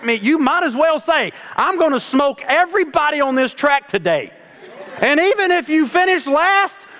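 high, fired-up pulpit voice; fiery preaching. Real, not acted. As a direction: preach it hard to the crowd. Preaching at full force.

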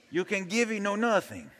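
joking Mexican accent impression. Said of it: "English in the Mexican accent".

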